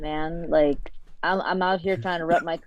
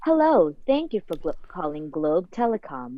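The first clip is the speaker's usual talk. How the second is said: Siri; slower than usual. Acted. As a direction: greet callers like a recorded telecom menu. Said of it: "just do Siri voices".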